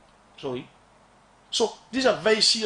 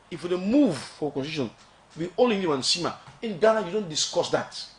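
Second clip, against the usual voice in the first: usual speaking voice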